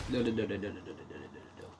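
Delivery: from quick "no" to a dying mumble; fading no's. Real, not acted. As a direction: let the "no, no" fade away in despair. Fading panicked nos.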